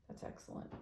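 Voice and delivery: soft whispered approval; whispered aside. Real, not acted. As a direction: breathe it out as a whisper. Quiet aside to herself.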